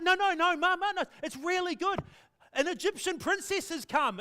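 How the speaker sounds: put-on girl reassuring her mother, excited; excited little girl. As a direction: burst in with the good news. Daughter voiced in retold story.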